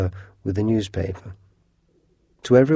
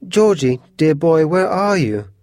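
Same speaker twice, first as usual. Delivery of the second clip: high, raised, anxious calling; alarmed cry; 6 semitones higher, 4 dB louder than usual. A narrator's reading. Mother calls for missing Georgie, crying out anxiously. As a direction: call out for a missing child. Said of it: "cried Missus Otis".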